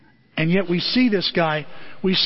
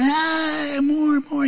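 put-on high babbling toddler asking for more; baby voice; 9 semitones higher, much slower than usual. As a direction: coo it like a baby wanting food.

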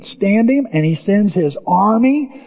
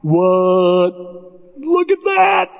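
stunned exclamations, last one very high; hammed-up disbelief, ending in a high squeal; acted amazement; 7 semitones higher, much slower than usual. Servant seeing chariots of fire, acted astonishment. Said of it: "I just think he".

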